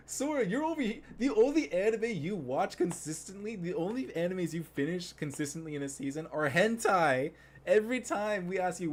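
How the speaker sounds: loud, high-pitched outburst at a friend; heated teasing rant. Real, not acted. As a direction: call out a friend, exasperated and loud.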